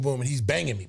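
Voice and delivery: ordinary talk, nothing marked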